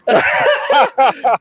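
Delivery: high burst of laughter; cracking up at his own joke. Real, not acted.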